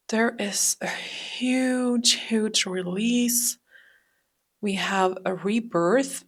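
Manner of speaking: slow, drawn-out whisper. Real, not acted. Reader slowly whispers the big release to the viewer. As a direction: breathe the words out slowly.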